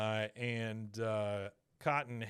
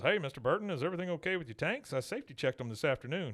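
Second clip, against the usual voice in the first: eager, fast nervous chatter; Hank rushing; 2 semitones higher, much faster than usual. Retold or imitated. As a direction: hurry it out like a worried landlord. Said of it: "he goes".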